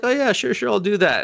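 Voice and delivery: high, sing-song fake agreement; mock agreement. Villain mocking the hero with fake agreement, high sing-song voice. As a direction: pretend to go along, sweetly.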